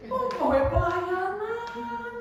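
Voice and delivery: high, loud, drawn-out teasing; mock taunting. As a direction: taunt in an exaggerated singsong, stretching the words. Mock scolding voice, denied as God's. (ejekan nyaring dipanjang-panjangkan)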